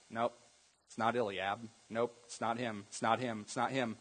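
low, hushed string of refusals; dismissive. Acted. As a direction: wave each one away under your breath. Samuel rejects each son.

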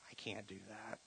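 whispered helpless aside. Retold or imitated. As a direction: breathe it out in a whisper. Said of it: "the grandpa is like".

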